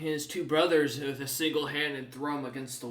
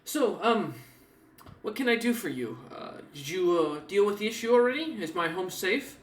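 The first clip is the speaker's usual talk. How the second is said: lightly higher, anxious woman; a hint of worry in her asking; worried NPC; 5 semitones higher than usual. Woman asking the party if her home is safe, lightly anxious. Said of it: "kinda looks at you and goes".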